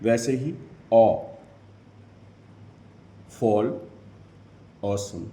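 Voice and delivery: usual speaking voice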